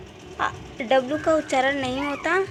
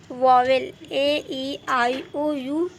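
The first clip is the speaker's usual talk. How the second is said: Vowel ए ई आई ओ यू spelled out slowly, letter by letter; slow spelled vowels; much slower than usual. Shown as a demonstration. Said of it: "teacher spells vowels slowly". (ए ई आई ओ यू धीरे-धीरे एक-एक अक्षर)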